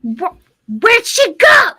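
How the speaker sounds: hammed-up witch yell; witch shouting. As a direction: screech in outrage at the empty hut. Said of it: "was like".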